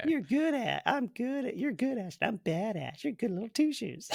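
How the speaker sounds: high, soft sing-song mockery; squeaky taunting voice. Guesser quoting Army of Darkness, in a high squeaky taunt. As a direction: put on a little squeaky teasing voice.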